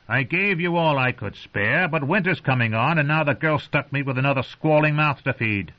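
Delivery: rough sneering wildling. A narrator's reading. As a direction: sneer at the unwelcome guests. Craster's voice.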